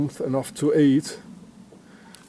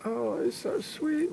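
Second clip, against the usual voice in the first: mock coo; 8 semitones higher, 8 dB quieter, slower than usual. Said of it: "the women like that".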